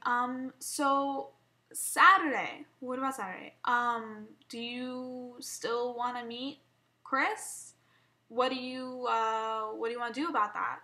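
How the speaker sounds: halting, hesitant asking with fillers; tentative, broken-up phrases; nervous stuttering. Retold or imitated. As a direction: imitate tiptoeing nervously into a touchy question. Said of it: "I started stuttering".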